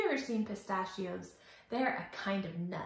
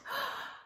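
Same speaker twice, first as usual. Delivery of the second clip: surprised gasp; 6 dB quieter than usual. Acted. Storyteller gasps at colour change.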